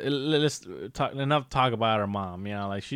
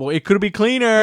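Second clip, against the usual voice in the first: mock mom; 9 semitones higher, 6 dB louder than usual. Voicing mom's cleaning habit.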